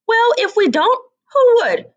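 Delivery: high, loud indignant squawk; a shocked hen crying out high. Acted.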